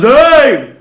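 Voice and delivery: sudden loud threatening shout; bar fight yell. Retold or imitated. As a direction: yell it like a thug with a broken bottle. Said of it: "points it at another guy and goes".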